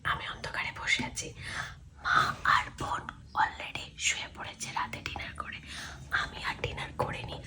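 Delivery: hushed whisper in the dark. Real, not acted. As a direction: whisper so no one wakes up. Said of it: "আর আস্তে আস্তে কথা বলছি।". (ফিসফিস করে বলা)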